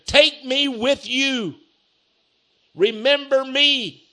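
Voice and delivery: high, drawn-out preacher's plea; pleading. Retold or imitated. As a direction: beg to be taken along, slowly. Preacher acts thief's plea to Jesus.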